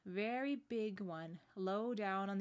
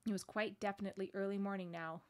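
ordinary delivery

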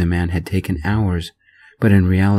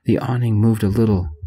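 ordinary delivery

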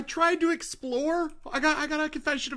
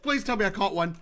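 ordinary delivery

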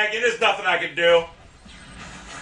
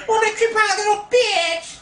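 high-pitched, stretched-out jeer; angry taunt; 12 semitones higher than usual. Real, not acted. Gamer mocking a creeping opponent, high angry jeer. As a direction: taunt the opponent, dragging out the insult.

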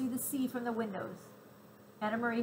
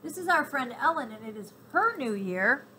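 loud cheerful chatter; 4 semitones higher, 7 dB louder than usual. A narrator's reading. Child chatters to soldier.

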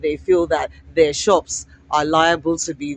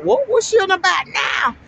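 mocking doubters; 11 semitones higher than usual. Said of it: "They were like".

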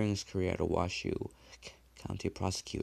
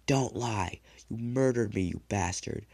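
loud furious shout, pitched up; angry scream; 3 semitones higher, 7 dB louder than usual. A narrator's reading. Dead man screams at his killer in fury. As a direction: scream the accusation in rage. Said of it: "He screamed".

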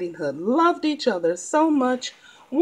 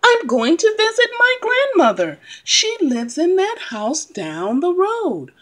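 put-on high, eager little girl; bright, innocent child voice, higher; 7 semitones higher than usual. Girl answers the fox.

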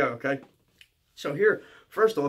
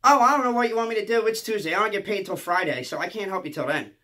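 higher-pitched mother brushing off a plea, loud at first; mock mom's excuse, starting high and settling down; put-on unhelpful mother voice; imitated mom; 6 semitones higher, 5 dB louder than usual. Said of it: "I call my mom".

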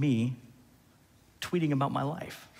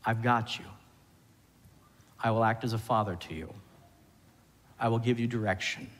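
quieter, lower, tender promise; reassuring God; 4 semitones lower, 4 dB quieter than usual. Acted. God reassuring his people, quiet and tender. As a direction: soothe them like a caring father. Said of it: "he says".

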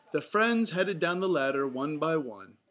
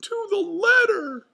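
high, very loud falsetto yell; Mickey Mouse yell; 12 dB louder than usual. A narrator's reading. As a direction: yell urgently as a cartoon mouse. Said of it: "yelled Mickey".

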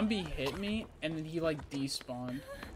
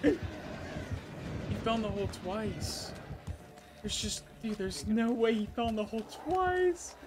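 dawning joy; 4 semitones higher, 9 dB louder, slower than usual. Real, not acted. Friends gaming, unbelievable enemy blunder.

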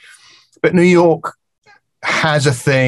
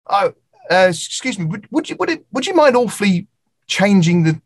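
mock-apologetic British customer; a shy British diner too polite to complain; timid polite Brit; 7 semitones higher than usual. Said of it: "A British person will go".

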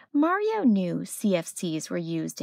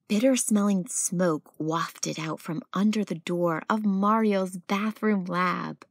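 ordinary delivery, nothing marked

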